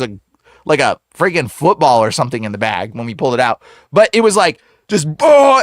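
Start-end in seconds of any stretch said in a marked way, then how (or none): 5.2-5.6 loud, high drawn-out whoosh of a noise; imitated heaving burst. Teller imitates it coming out of the man, loud heave. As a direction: burst it out like something expelled. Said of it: "and he comes out".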